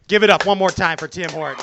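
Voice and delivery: big crowd call-out; loud announcer shout. Real, not acted. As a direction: hype the audience loudly. Host calls for applause.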